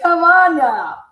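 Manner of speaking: hammed-up exasperated plea; mock pleading. Retold reply to friend.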